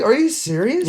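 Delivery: high, incredulous; can't believe the call; disbelief. Retold or imitated. Coach retells asking the ref if he's serious.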